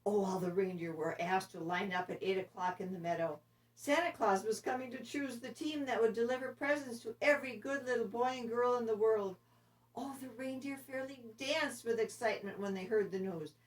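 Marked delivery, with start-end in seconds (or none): none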